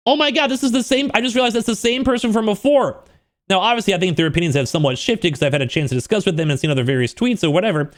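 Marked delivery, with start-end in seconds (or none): 0.1-3.0 high-pitched rush of recognition; excited realization. Retold or imitated. Realizing it's the same person, excited and high. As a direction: exclaim it high, as if just realizing. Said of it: "So I got excited from that".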